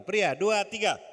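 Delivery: high, loud count-in; rally the crowd with a shouted count; loud cueing. Real, not acted. Preacher cues women and men to repeat, calling out loudly.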